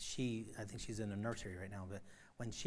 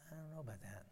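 much softer, skeptical; doubtful aside; 3 semitones higher, 10 dB quieter, faster than usual. Real, not acted. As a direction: drop it quietly with doubt.

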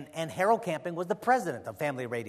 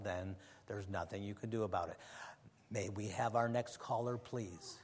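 low, soft, dry and unmoved reply; imitating Camping; 8 semitones lower, 8 dB quieter than usual. Radio host coolly dismissing the desperate caller, low and soft. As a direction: answer the pleading caller with a cold shrug. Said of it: "And this was the answer".

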